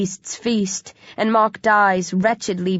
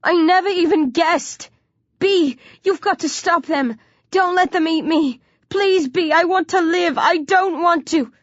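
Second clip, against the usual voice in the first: high-pitched, desperate begging; wailing; 9 semitones higher than usual. A narrator's reading. Trembling boy begging friend to save him, wailing. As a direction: beg for life in a high wail. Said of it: "Mark wails".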